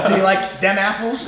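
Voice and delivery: Boston movie quote. Retold or imitated. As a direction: quote the Matt Damon line in a playful Boston accent. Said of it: "Do you guys like Matt Damon".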